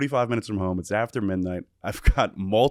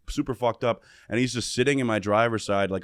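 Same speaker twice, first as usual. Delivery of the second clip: ordinary delivery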